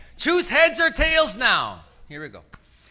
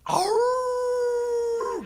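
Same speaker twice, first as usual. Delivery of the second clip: much higher howl, stretched out; wolf howl; 11 semitones higher, 6 dB quieter than usual. Shown as a demonstration. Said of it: "I want everybody to go".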